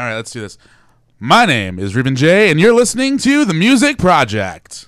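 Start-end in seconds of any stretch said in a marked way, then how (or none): 1.2-4.9 loud announcer, slow start rising high; over-the-top radio voice. Pretend. Guest going last to win, big over-the-top radio voice. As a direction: belt out the station intro, swooping up. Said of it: "Best radio voice, over the top".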